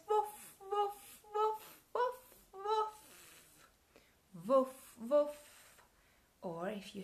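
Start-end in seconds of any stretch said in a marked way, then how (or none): 0.1-5.5 high sung woofing; dog woofs. Pretend. Teacher woofs like a dog for toddlers. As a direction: woof like a playful dog. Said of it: "And she says".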